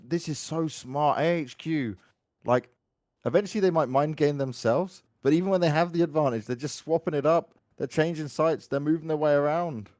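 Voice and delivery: brisk play-by-play call; esports caster. Acted. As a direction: narrate the play as it happens. Casting an attacking play.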